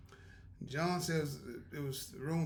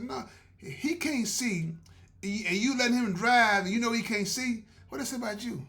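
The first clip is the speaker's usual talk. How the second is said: teasing scolding; 7 semitones higher, 7 dB louder than usual. Retold or imitated. Scolding, teasing about letting him drive.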